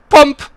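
high, loud "pomp" sound effect; sudden punched comic thud noise; imitated bump. Imitates bump after grazing something.

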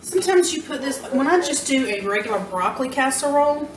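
normal talking voice

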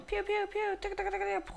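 high blaster pews then tuk tuk gunfire; gun sound effects. Pretend. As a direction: rattle off playful mouth gunfire. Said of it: "I'm gonna make sound effects".

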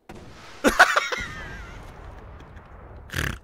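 long laugh tailing away; real burst of laughing, then fading out; helpless laugh. Real, not acted. Gamer testing his homing missile launcher, helpless laugh.

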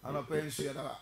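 soft, low prayer voice. Real, not acted. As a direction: pray quietly and humbly before the sermon. Opening prayer, quiet and low.

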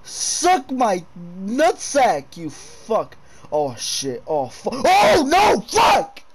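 high, loud shrieks and curses; real panic, yelling at the monster; terrified screaming. Real, not acted. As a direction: scream and swear at the thing attacking. Monster attack, panicked shouting.

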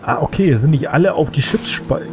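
ordinary talk

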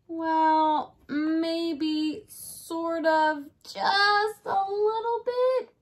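high, sing-song sheepish admission; sheepish little voice. Acted. Dinosaur daughter admits to dad, sheepish sing-song. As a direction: drag out the guilty little confession.

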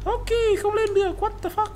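high whiny complaint. Real, not acted. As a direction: whine at the wall. Frustrated at climb. (giọng cao than vãn)

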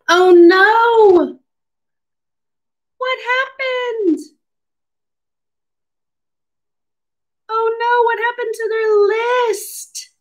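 high-pitched, theatrical storybook dismay; mock alarm. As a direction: play up the disaster for small children. Acting alarm at lost list.

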